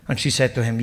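normal talking voice

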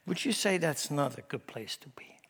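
normal talking voice

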